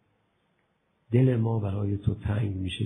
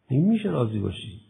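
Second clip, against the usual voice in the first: normal talking voice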